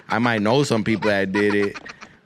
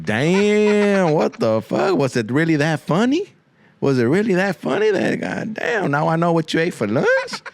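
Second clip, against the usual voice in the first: higher, exaggerated incredulous reaction; mock disgusted disbelief; 6 semitones higher than usual. Friends react to a laugh ending in burp, appalled. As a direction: stretch the opening cry, then question in mock disbelief.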